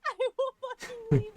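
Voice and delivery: laughing. Real, not acted. Laughing through the protest.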